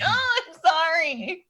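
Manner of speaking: high, small apologetic voice; a meek kid squeaking sorry; high timid kid voice. Pretend.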